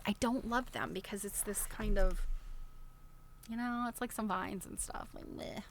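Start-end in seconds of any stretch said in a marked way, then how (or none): none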